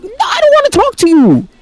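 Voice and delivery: very high-pitched mock refusal; indignant whine. Boy snubbing the now-nice girls, indignant high whine. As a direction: whine the rejection, hammed up.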